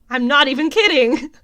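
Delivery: loud, high, laughing exclamation; real excited laughing. Real, not acted. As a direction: burst out giddy with laughter. Thrilled fan laughs over dream match-up.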